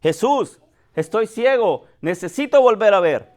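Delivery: a beggar shouting his need to Jesus; pretend shout. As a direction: call out pleading to be healed. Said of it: "le grita y le dice".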